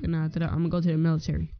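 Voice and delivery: usual speaking voice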